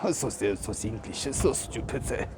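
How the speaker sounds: lightly put-on mock German accent, sneering; a hint of a German accent, mocking the British. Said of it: "I think that's German".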